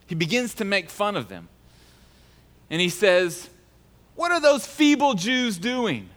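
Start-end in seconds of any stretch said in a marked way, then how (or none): 4.2-6.1 slow, higher, scornful sneer; mocking sneer. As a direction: drag it out like a jeering bully.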